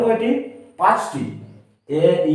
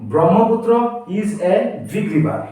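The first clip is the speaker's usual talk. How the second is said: is a big river said very slowly; slow example read; slower than usual. Shown as a demonstration. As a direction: read it out for dictation. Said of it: "blank দেওয়া আছে". (ধীরে উদাহরণ পড়া)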